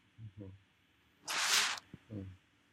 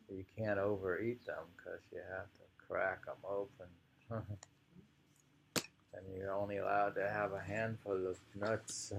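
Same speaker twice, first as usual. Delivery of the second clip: normal talking voice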